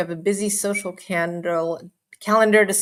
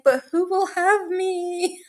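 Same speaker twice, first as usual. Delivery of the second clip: high, slow, drawn-out pitiful plea; a hammed-up lament about being unwanted; mock plaintive whine; 10 semitones higher, slower than usual. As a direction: play it like a lonely orphan. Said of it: "It's like".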